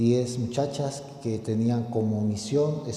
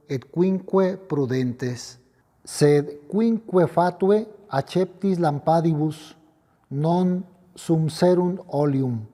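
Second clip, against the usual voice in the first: raised, solemn gospel proclamation; liturgical proclamation; 6 semitones higher, 5 dB louder than usual. Acted. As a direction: proclaim it like a priest at the altar. Latin gospel proclaimed at Mass.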